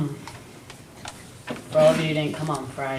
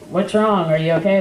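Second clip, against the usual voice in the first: louder, higher worried check; acted urgent concern, raised voice; retold alarm; 4 semitones higher, 7 dB louder than usual. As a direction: call out in mock alarm. Retells rushing to hit father.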